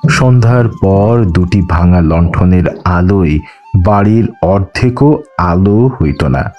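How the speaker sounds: ordinary delivery, nothing marked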